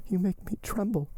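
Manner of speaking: high-pitched, uneasy heroine; fearful Valentine. Acted. Valentine alarmed by Morrel's plan, fearful. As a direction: raise the voice in alarm.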